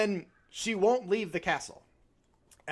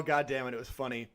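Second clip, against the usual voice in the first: ordinary talk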